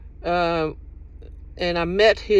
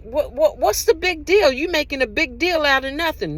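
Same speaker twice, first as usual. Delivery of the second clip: higher, laughing brush-off of the complaint; mock dismissive excuse; 7 semitones higher than usual. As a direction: play the toxic person waving it away with a laugh. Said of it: "they'll turn around".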